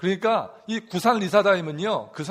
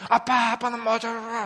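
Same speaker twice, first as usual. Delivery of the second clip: higher, whiny mock of the youngest talking back; child's petulant retort, higher pitch; sulky teenage child; 5 semitones higher than usual. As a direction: mimic a sulky kid snapping at dad. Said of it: "막 그러면서 화가 나가지고".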